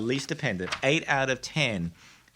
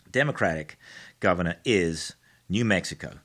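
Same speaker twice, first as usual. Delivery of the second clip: ordinary talk, nothing marked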